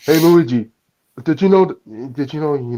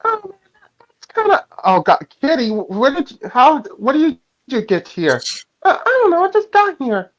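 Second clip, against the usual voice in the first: put-on much higher, surprised girl's voice; high Kitty voice; 10 semitones higher than usual.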